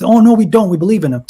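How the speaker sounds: mocking denial.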